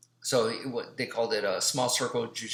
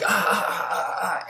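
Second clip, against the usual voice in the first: higher, repeated mock yelps; imitated pain cries; 7 semitones higher than usual. Students led around by a finger lock, mock yelps. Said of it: "people would be like".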